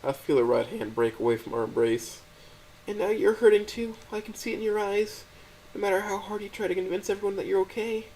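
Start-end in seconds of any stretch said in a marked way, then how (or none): 2.9-8.1 put-on a crying girlfriend pleading to help; high, gentle, tearful voice; tearful Stella. A narrator's reading. Stella's voice in the story.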